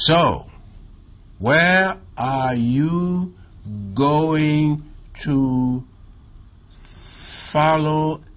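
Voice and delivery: very slow, high, broken-up reading, word by word; imitating his own stumbling first audition read; halting, disjointed reading. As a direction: sound out each chunk like a poor reader stumbling through a script. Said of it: "my reading came out very halting and very disjointed".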